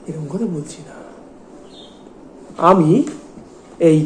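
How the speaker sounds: normal talking voice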